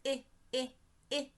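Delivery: "I" as a short "ih", repeated with gaps; short I sound. Shown as a demonstration. Teacher shows how igloo begins, "I" as short "ih". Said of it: "begins with the I, I, I sound".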